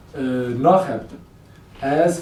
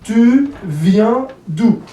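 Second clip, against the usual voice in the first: very slow, higher, each word stretched; slow, ringing teacher's demonstration; word-by-word model; 5 semitones higher, 4 dB louder, much slower than usual. Shown as a demonstration. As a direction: separate each word emphatically for learners.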